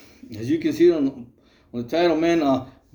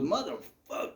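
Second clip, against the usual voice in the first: mock pained groan; 13 dB quieter than usual. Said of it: "He goes".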